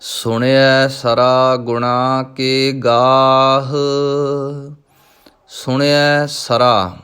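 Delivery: slow, measured recitation of scripture; Gurbani recitation. Shown as a demonstration. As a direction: intone each line with devotion. Recites Gurbani lines in lesson. (Gurbani ਦਾ ਸਹਿਜ ਪਾਠ)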